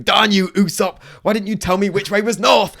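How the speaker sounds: high, loud hammed-up yell; mock outrage as Luffy. Luffy yelling at Usopp about north, high and loud. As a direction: rail at Usopp in a silly fury. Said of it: "he's going".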